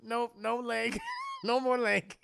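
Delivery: imitating barely stifled giggles; trying not to laugh. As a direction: squeeze the words out between chuckles. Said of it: "Michael Parks trying not to laugh".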